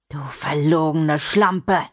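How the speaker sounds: slow, contemptuous man's voice; angry insult. Acted. Intruder John accusing Mimi of lying, angry. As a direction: spit the insult slowly and angrily.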